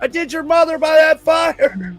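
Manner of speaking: yelling cartoon birds. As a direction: squawk and yell like angry birds. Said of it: "random birds and trees yelling at each other".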